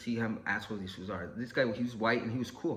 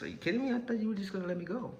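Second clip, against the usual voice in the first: high-pitched disbelief; incredulous protest; 8 semitones higher, faster than usual. Retold or imitated. Man told he gets a year questions officer, high incredulous voice. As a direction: retell a shocked plea in a raised pitch.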